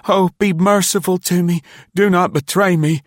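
high, loud, frantic pleas for mercy; begging and pleading. A narrator's reading. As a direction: beg for your life in a high, crying voice. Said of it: "begged and pleaded".

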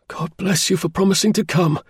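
high-pitched, fervent client voice; grateful cry. A narrator's reading. Client thanking Holmes for promising to come, grateful cry. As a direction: cry out thanks, without much added volume. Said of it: "cried our client".

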